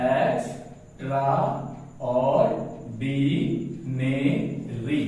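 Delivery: slow "extraordinary" in six separate beats; syllable by syllable. Shown as a demonstration. Teacher making syllables of a big word, slow separate beats. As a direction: split "extraordinary" into ex, tra, or, di, ne, ri. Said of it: "word का syllable बनाता हूं".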